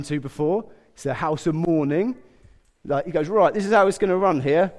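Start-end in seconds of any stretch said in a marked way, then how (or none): 3.3-4.7 slightly louder, lightly acted officer taking charge; police-film boss. Jesus arriving at the house of mourning, lightly bossy. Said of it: "like, one of those police films".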